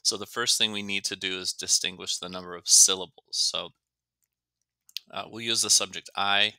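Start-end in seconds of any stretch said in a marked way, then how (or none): none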